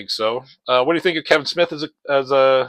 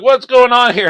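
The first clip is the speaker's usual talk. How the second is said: startled shout; 9 semitones higher, 7 dB louder than usual. Retold or imitated. Thugs startled by crash.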